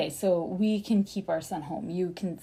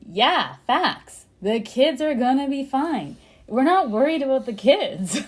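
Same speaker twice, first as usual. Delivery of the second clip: raised, louder mock retort to the letter; emphatic dismissal; 4 semitones higher, 6 dB louder, slower than usual. Parent retorting to the school's letter, emphatic dismissal. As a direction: act out a worked-up, dismissive reply.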